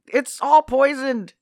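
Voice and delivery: high, slow alarmed cry; alarmed quote. Retold or imitated. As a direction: gasp it out like they've just realised. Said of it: "and they were like".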